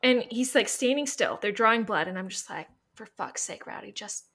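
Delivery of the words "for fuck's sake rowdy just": hushed exasperated plea. Retold or imitated. Owner to dog during blood draw, hushed pleading. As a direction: plead under your breath, very softly.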